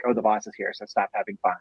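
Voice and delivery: hushed warning. Retold or imitated. Staff warning each other the boss is here, hushed warning. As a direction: pass a low warning among coworkers. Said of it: "everyone's like".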